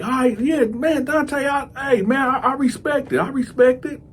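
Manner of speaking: nervous, pitched high to please; squeaky eager agreement. Retold or imitated. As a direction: voice jumps way up, placating. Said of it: "He was like".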